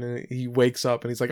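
ordinary delivery

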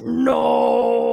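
loud, crying-out mock No from the mentor; forceful No. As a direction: slam it down like a fist on the table. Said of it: "pounded his fist on the table and he was like".

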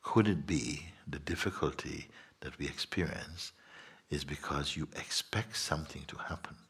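soft, low, near-whispered musing; quiet satsang reflection, sinking to a whisper; hushed contemplation. Acted.

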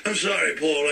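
ordinary delivery

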